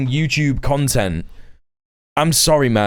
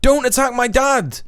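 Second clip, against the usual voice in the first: usual speaking voice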